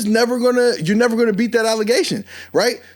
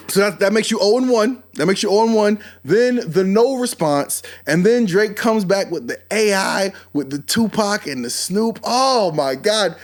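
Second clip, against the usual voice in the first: ordinary talk, nothing marked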